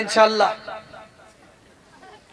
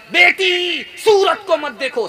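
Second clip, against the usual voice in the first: higher, louder call of Beti; raised admonition; 7 semitones higher, 4 dB louder, slower than usual. Acted. Father urging his daughter, raised and emphatic. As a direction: lift the voice to drive the lesson home.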